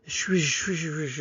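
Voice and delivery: hushing, repeated "swish"; imitated wind in leaves. Shown as a demonstration. Early humans naming trees by their sound, imitated swishing. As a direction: blur "swish" into rustling leaves. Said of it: "all these things sound this way when wind blows".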